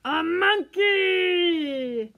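very slow, loud excited cry; delighted shout. Acted. Reading to children, loud delighted monkey reveal. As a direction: shout the surprise out.

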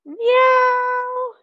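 high, loud, drawn-out meow; cat meow. Pretend. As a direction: squeal like a cat. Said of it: "And cat".

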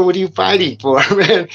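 a laughing taunt from the stabber; mocking laugh. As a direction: laugh at him while asking. Popeye taunting after stabbing.

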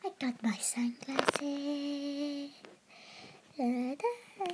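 slow whisper. Real, not acted. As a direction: whisper it slowly. Narrator whisper aside.

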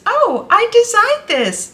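high, loud, drawn-out reveal; imitated shopkeeper. Designer telling a customer her pattern, high and excited. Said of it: "And the woman says".

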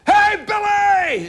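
high, drawn-out mock holler; imitated long street shout; calling out. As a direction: stretch it out like a passer-by calling. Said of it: "what people do is they go".

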